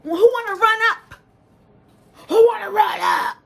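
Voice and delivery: high-pitched imitated battle holler; all-out shouting. Mimicking the girl daring people to run up, shouting. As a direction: scream out a challenge to fight. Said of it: "I ain't hear no baby voice".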